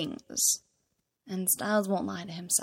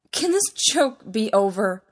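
loud, fed-up outburst; raised voice, thoroughly exasperated; 2 semitones higher, 6 dB louder than usual. A narrator's reading. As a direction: demand it, at the end of your patience. Fed-up character pushes gift back.

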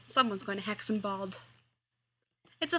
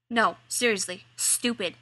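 snapping; 5 dB louder than usual. Acted. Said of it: "Hikaru snaps".

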